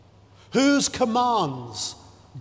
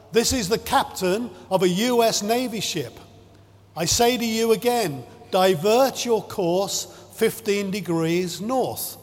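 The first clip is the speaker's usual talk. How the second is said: normal talking voice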